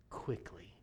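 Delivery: low, hushed quickly; breathy low whisper. Acted. Refrain whispered.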